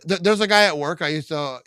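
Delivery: ordinary talk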